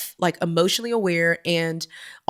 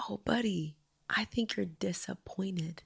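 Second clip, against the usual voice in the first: slow, hushed motherly comfort; softly name a child's hurt, almost whispering; very quiet, gentle retelling; tender consoling; 2 semitones lower, 12 dB quieter, slower than usual. Retold or imitated.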